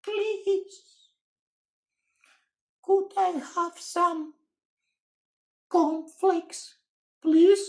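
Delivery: high, timid little voice; timid small voice. Acted. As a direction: ask shyly, barely daring. Timid Mister Quiet asks.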